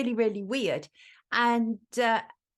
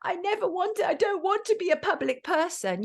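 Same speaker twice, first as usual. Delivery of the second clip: high-pitched mocking quote; Alec Baldwin; 10 semitones higher than usual. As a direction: imitate a complaint, pitched well up. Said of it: "Alec Baldwin on the phone to Hilary".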